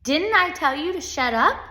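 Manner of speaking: high, loud scolding question; cross teacher's challenge; demanding. A narrator's reading. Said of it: "she demanded".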